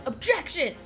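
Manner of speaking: high, loud yelled objection; courtroom yell. Acted. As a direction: yell it out like the game's lawyer. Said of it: "the person who yells".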